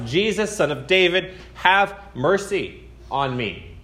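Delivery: a first mild plea across the crowd; calling out. Retold or imitated. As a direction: call out to Jesus for mercy. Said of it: "And he shouts".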